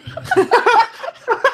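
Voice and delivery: shrieking high laugh; high cackling laugh. Real, not acted. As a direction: cackle wildly at your own joke. Howling laugh after crude joke.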